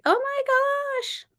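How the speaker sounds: stunned "oh my gosh"; shocked. Real, not acted. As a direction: gasp it in sympathy. Shocked at viewer news.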